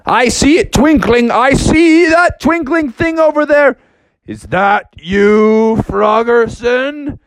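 eager bear voice. Acted. Bear spots twinkling.

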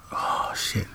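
hushed whisper, telling a secret; retelling the movie to a friend, dropping into a whisper; whispered aside. Acted. Soft unvoiced whisper.

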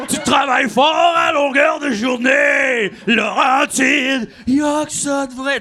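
beer ad announcer. Retold or imitated. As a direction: hype it like an eighties beer ad. Imitating beer commercial voiceover.

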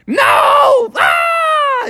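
very high cartoon shriek; panicked scream. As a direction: scream as a terrified little groundhog. Groundhog threatened with being eaten.

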